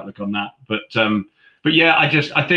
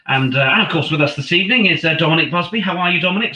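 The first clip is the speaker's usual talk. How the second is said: higher, louder broadcast introduction; on-air commentator; 9 semitones higher, 5 dB louder than usual. Retold or imitated. Commentator introducing surprise summariser on air, lifted broadcast voice. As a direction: bring in the co-commentator as if live on air.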